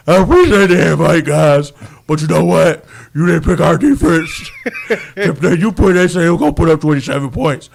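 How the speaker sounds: loud put-on lineman voice, laughing through it; big booming player voice, teasing; Calais Campbell impression. Host imitates Calais Campbell mocking the offense, loud laughing voice. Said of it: "my Calais Campbell voice".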